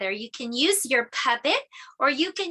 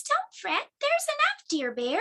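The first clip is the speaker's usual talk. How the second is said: much higher squeaky little mouse voice; 12 semitones higher than usual. Acted. Teacher voicing Mouse comforting Bear, squeaky. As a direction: squeak it tiny and high. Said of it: "Mouse squeaks".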